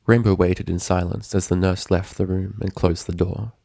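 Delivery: ordinary delivery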